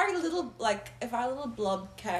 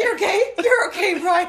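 laughing; 8 semitones higher, 10 dB louder than usual. Real, not acted. Reassuring Brian while laughing.

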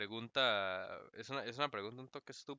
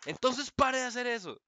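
respuesta alterada; 11 semitones higher, 7 dB louder, slower than usual. Retold or imitated. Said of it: "Y yo como".

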